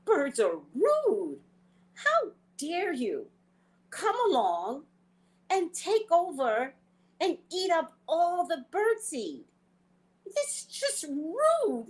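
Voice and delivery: high, slow mock outrage at the birds; play-scold the greedy birds; indignant scolding. Scolding the doves who eat the birdseed, in high, slow mock indignation. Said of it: "I'm thinking".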